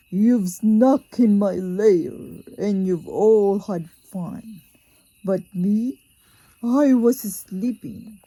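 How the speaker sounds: loud, gruff grumbling bear voice; grumpy bear. Acted. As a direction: roar out the bear's grievance. Woken bear complains loudly.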